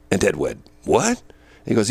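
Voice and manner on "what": surprised. Retold or imitated. Boss shocked at new voice.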